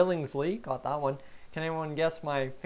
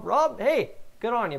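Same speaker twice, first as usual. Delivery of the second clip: usual speaking voice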